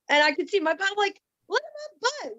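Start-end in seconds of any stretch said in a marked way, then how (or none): 1.5-2.4 soft, very high-pitched astonishment; admiring self-talk. Retold or imitated. Woman alone at bathroom mirror, delighted self-talk. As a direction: marvel at yourself in a high, hushed squeal. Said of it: "talking to myself like this".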